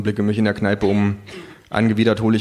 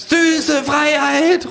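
high, drawn-out proclamation; kangaroo proclaiming; 4 dB louder, much slower than usual. A narrator's reading. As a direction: cry it out like a speech from the bar. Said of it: "ruft das Känguru".

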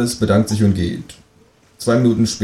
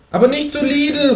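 much higher kangaroo calling out; kangaroo calling after. Acted. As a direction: call after him as the kangaroo. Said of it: "ruft mir das Känguru hinterher". (das Känguru ruft hinterher, hohe Stimme)

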